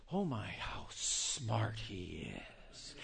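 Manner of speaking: slow, soft swooning over cleverness; mock awed admiration. American students admiring a British lecturer, mock awe. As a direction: gush quietly, drawing it out in mock awe. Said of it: "all the guys that are over there".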